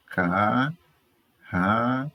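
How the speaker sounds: CARRAPATO very slow, car, ra, pa, to with pauses; syllable by syllable. Shown as a demonstration. Teacher showing the separation of carrapato, syllable by syllable. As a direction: separate each syllable of carrapato with a long gap. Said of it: "como é que faz a separação silábica".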